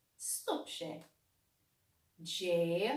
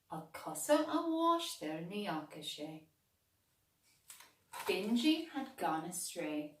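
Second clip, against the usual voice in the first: plain reading voice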